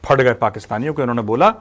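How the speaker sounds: ordinary talk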